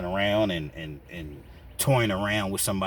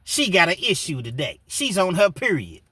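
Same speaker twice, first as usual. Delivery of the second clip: mocking taunt; 7 semitones higher, 5 dB louder than usual.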